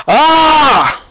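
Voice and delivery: high, loud mock yelp of pain; pained yell. Friend falling on the court, imitated high loud yelp. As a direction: cry out like you just twisted your ankle. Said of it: "and he's just like".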